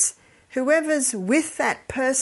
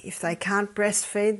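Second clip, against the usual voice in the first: normal talking voice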